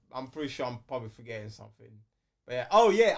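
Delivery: usual speaking voice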